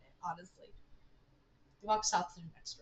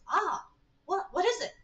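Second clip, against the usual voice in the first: high, louder startled yelp and question; 9 semitones higher, 5 dB louder than usual. Acted. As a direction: jump at it, pitch shooting up. Acted startle on touching something.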